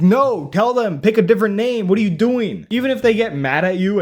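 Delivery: loud, incredulous outburst; exasperated urging. Acted. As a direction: tell them off in disbelief. Exasperated advice to polite relative.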